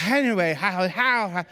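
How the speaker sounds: panting ha ha through burning mouth; mouth on fire. Shown as a demonstration. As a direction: puff out air between words to cool the mouth. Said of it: "trying to exhale while you're just, like, trying to talk through the pain".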